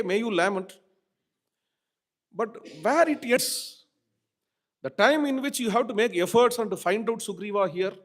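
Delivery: high, loud impatient scolding; a younger brother scolds the grieving Rama loudly. Retold or imitated. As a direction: shake him out of his grief. Lakshmana scolding lamenting Rama.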